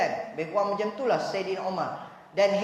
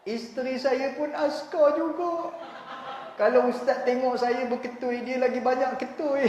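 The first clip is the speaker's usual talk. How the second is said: hammed-up beaten husband; 3 semitones higher than usual. Husband telling the ustaz his wife hits him, plaintive. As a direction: confess it like a wronged man.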